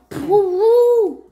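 loud, drawn-out pretend trumpet; elephant trumpeting. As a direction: toot like an elephant's trunk. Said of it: "elephant has a long trunk".

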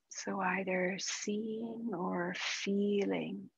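calm, unhurried meditation guide with long pauses; guided meditation. Acted. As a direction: ease them gently into stillness, softening as it goes.

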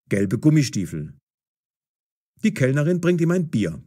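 usual speaking voice

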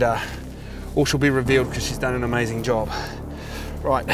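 ordinary talk, nothing marked

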